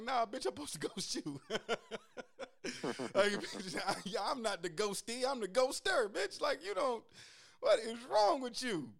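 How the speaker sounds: high, loud, laughing indignation; mock offended diva. To the girl who ghosted him, high hammed-up indignation. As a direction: play it up like a wronged diva. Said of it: "I'm like".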